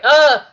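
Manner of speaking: loud anguished ah; frustrated cry. Retold or imitated. Isaac forced to choose, loud anguished cry. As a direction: burst out with a torn-up yell. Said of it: "Isaac's just like".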